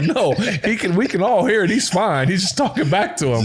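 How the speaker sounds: high-pitched imitated protest; exasperated correction. Quarterbacks telling equipment guys the battery is fine, exasperated. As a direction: explain it in amused, high exasperation.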